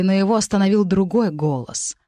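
plain reading voice

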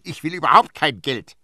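indignant refusal; heated retort. A narrator's reading. Nurse argues back at priest.

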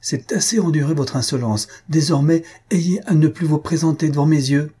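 high, indignant authority; commanding. A narrator's reading. Countess banishing the insolent baron, commanding. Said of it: "avec son autorité irrésistible".

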